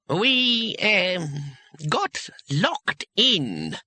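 high, very slow, halting admission; reluctant. A narrator's reading. Embarrassed wizard admits the predicament, reluctantly. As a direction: drag out each word unwillingly.

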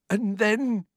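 high, shaken voice; shaky. A narrator's reading. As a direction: carry on, still shaken. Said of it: "said Dudley shakily".

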